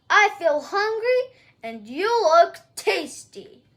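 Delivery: loud hungry roar; giant roaring. Acted. Giant grabbing the cowgirl, hungry roar. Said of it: "he roared".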